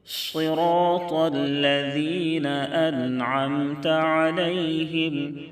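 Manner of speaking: slow, high, melodic tajweed chant; slow melodic Quran recitation. Shown as a demonstration. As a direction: draw each vowel out in a sung recitation. Recites verses.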